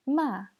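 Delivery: "Ma" high and loud, falling fast; fourth tone. Shown as a demonstration. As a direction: drop each "Ma" sharply from high. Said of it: "bien prononcer les quatre tons".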